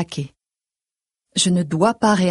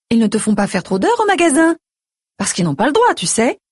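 higher-pitched concerned mother; fussing mother; 6 semitones higher, 3 dB louder than usual. A narrator's reading. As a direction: fuss over your daughter in a higher voice.

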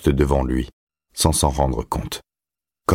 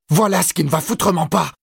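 put-on very high-pitched angry boy; furious, piercing outburst; enraged. A narrator's reading. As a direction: spit it out in a high rage. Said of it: "dit Todd avec rage".

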